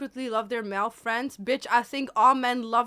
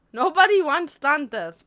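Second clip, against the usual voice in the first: loud; 3 semitones higher, 7 dB louder than usual. Acted. Teasing chat loudly.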